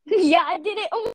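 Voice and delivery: words breaking into real laughter; laughing. Real, not acted. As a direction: giggle through the words. Laughing at the robot glitch.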